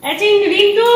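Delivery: high, loud call across the house. Real, not acted. Mother calls her son Rintu from afar, loud call. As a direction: shout for Rintu from another room.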